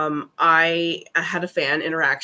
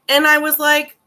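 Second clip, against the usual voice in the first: excited; 10 semitones higher, 6 dB louder than usual. Real, not acted. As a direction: burst out in recognition. Recognizing the fan's username.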